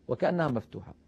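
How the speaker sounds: ordinary talk, nothing marked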